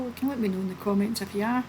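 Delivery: ordinary talk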